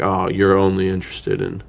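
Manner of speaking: ordinary delivery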